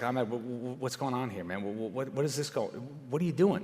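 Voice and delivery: soft, stammering retold questions; hushed disbelief. Speaker retells asking the investigator what's going on, hushed disbelief. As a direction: imitate quietly confronting a colleague, stumbling over words.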